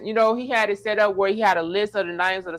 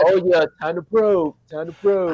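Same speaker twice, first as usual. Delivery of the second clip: slow, drawn-out alien voice; 4 dB louder than usual. Retold or imitated. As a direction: do a goofy alien probing. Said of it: "see the alien voice".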